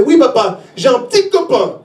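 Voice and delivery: put-on high little-girl voice; little daughter. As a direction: imitate a small girl telling her papa. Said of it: "Elle fait".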